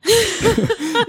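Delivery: high burst of real laughter. Real, not acted. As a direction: crack up at the joke on yourself. Realises ticket is senior.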